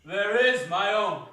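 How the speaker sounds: proud spirit, boom it out; hearty spirit. Acted. The spirit answers Scrooge, booming and proud.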